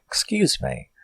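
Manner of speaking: high, soft, fake-polite excuse me; a prissy, high-pitched excuse me; mock polite. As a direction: feign politeness before striking. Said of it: "I would be like".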